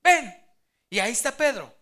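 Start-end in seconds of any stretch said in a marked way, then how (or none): 0.0-0.4 calling summons. Acted. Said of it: "le dice Jesús".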